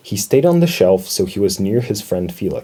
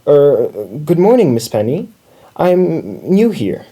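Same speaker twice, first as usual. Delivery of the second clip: higher, hesitant, polite introduction; 5 semitones higher, 4 dB louder than usual. A narrator's reading. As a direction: introduce yourself politely, with small pauses. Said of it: "introduced himself politely".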